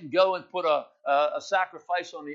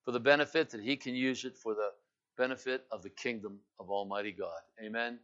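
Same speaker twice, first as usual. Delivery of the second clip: low, soft, measured sermon appeal; solemn preaching; 5 semitones lower, 6 dB quieter than usual. Real, not acted. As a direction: bring it down to a hushed, searching appeal with pauses.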